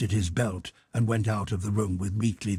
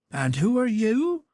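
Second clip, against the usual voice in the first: put-on high, guarded old-woman voice; wary old housekeeper; 12 semitones higher, 3 dB louder than usual. A narrator's reading. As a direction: challenge the stranger at the gate. Housekeeper questions stranger.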